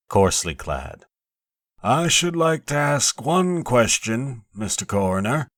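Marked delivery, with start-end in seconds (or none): 1.8-5.5 put-on a higher, slow, challenging voice for the tall old foreman; bearded foreman of sixty. A narrator's reading. Foreman of sixty challenging the coroner, slow and high. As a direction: lean on the question like a blunt old countryman. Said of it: "The foreman rose, a tall, bearded man of sixty".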